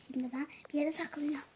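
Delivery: quick hushed words while hiding; whispering while hiding. Pretend. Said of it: "saklana saklana gidiyorum".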